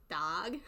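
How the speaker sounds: put-on northern "dog", said dahg; northern pronunciation. Shown as a demonstration. Amused retry of the northern way, "dog" as dahg. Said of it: "It's hard to say".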